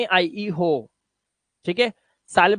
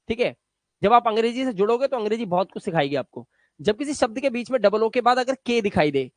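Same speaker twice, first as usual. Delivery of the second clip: ordinary talk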